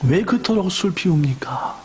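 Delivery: a dream figure gently asks the weeping mother why; gentle heavenly voice. Acted. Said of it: "그분이 말하기를 이렇게 말했습니다".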